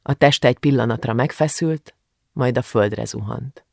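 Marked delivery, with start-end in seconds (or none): none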